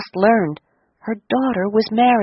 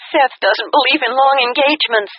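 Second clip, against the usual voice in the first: higher, bright daughter's voice on the phone; a higher young woman's voice, eager; 7 semitones higher than usual. A narrator's reading. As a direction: give it as the excited bride. Daughter quoting new husband, higher.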